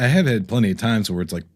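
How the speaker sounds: ordinary talk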